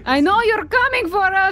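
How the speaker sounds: high-pitched, hammed-up threat; mock menacing call. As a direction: call out a mock warning to hidden enemies. Threatens the birds in the trees.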